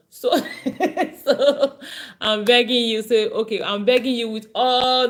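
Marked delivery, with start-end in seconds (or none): 0.3-1.2 amused laugh. Real, not acted. Laughs at her list.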